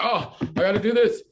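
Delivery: high, loud, frantic rush; frantic hysteria. Retold or imitated. Stressed American voiced by speaker, in frantic high hysteria. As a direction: blurt it like you're panicking over chores. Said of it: "in a state of hysteria".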